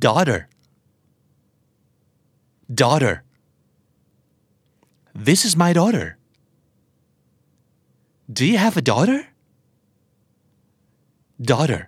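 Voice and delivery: "Daughter" said like "daw-der"; American flap T. Shown as a demonstration. Teacher drilling learners on daughter, flap T. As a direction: make the T in "Daughter" a quick D. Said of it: "T ที่อยู่กลางคำแล้วกลายเป็นเสียงตัว D".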